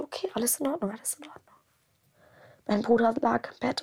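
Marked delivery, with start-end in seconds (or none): 0.9-1.4 barely breathed, fading to a whisper; a whispered echo to calm herself; whispered reassurance. Retold or imitated. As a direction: whisper it to yourself to stay calm. Calming herself, repeating reassurance.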